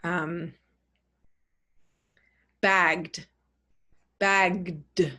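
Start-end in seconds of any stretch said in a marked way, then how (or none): 2.6-5.1 bagged ending in a voiced d. Shown as a demonstration. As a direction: keep bagged voiced right to the d. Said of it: "You've got to keep it voiced".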